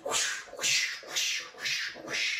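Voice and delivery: breathy whooshing flames; whispered whoosh. Pretend. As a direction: whisper the fire sound. Painting flames with sound effects.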